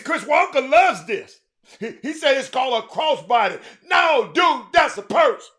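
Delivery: loud, high, laughing through it; laughing outrage, high and loud; 1 laughing shout. Real, not acted. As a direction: crack up while yelling at the purse. Laughing at the purse.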